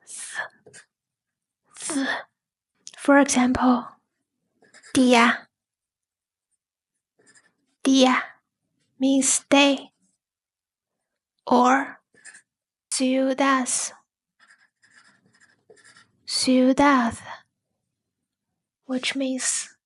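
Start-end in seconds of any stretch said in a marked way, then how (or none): 0.0-2.1 "th" as a soft, quiet dental fricative; word-final Spanish D. Shown as a demonstration. Teacher showing D at word end, soft isolated th. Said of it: "it's pronounced as". 12.9-17.2 "ciudad" with last D softened to th; word-final soft D. Shown as a demonstration. Teacher giving Spanish example for learners, soft th ending. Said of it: "If it's at the end of the word".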